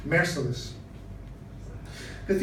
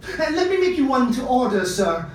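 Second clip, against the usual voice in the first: high, loud offer to a customer; eager shopkeeper; 7 semitones higher, 5 dB louder than usual. Acted. Costumier offers a customer a custom wig, eager and raised. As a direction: pitch up, pressing a sale.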